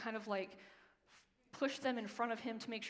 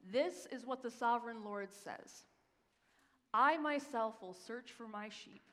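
scripture reading; 4 dB louder than usual. Acted. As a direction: read it as God speaking, weighty. Reads God's words from Ezekiel.

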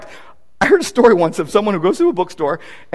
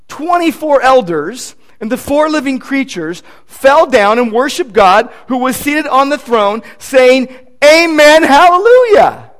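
building from a solid read to a loud ringing hallelujah, higher; fervent scripture reading; 5 semitones higher, 5 dB louder than usual. Acted. Preacher reading Revelation to his congregation, rising to loud fervour.

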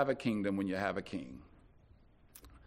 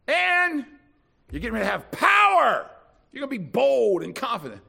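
much higher, shouted declaration; mock grand promise; 10 semitones higher, 9 dB louder than usual. Jesus promising power to timid disciples, shouted. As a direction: announce it like a grand prophecy. Jesus voiced in a retold scene.

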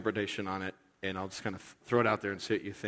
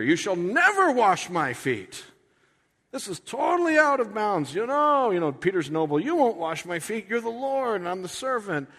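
indignant Peter; 9 semitones higher, 4 dB louder than usual. Retold or imitated. Peter protesting to Jesus, high and loud indignation. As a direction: protest high and hard like a scandalized disciple. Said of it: "says Peter".

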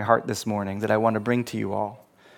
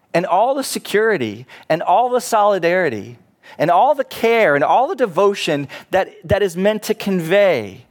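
building preaching; 3 semitones higher than usual. Real, not acted. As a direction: build the list to a passionate peak.

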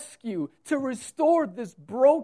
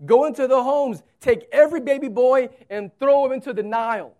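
commanding shout; 4 semitones higher, 8 dB louder, faster than usual. Acted. Preacher voicing Pharaoh's command.

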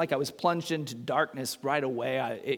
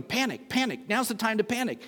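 higher-pitched mock panic; panicky inner voice; 6 semitones higher than usual. Inner voice in a dark cave, high mock panic. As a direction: play the voice in your head urging panic. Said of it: "this voice in my head going".

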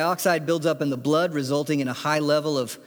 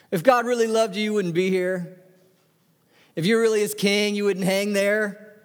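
raised-pitch jeer at a victim; derisive taunting; 6 semitones higher than usual. Acted. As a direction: taunt him, voice held high. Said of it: "passed by derided him".

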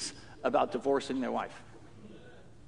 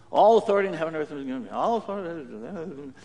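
mindless rote drone; 2 semitones lower than usual. Shown as a demonstration. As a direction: say it like worn-out background noise.

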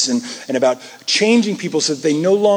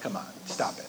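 exasperated murmur; 9 semitones lower, 17 dB quieter, faster than usual. Retold or imitated. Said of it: "And you're like".

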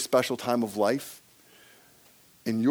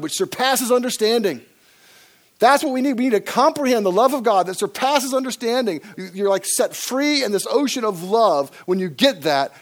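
raised, higher preaching voice; impassioned preaching; 9 semitones higher, 7 dB louder than usual. Real, not acted. Preacher urging the church to grasp God's love, impassioned. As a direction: preach it with mounting force. Preacher builds to full force.

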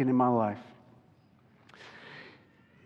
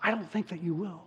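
desperate pleading prayer. Retold or imitated. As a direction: plead with God, half-whispered and desperate. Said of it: "Have you ever prayed like that?".

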